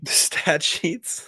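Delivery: slow, amused echo of a silly word; laughing disbelief. Real, not acted. Cohost mocking a guest's odd word for scorecards, laughing. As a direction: laugh and drag it out, incredulous.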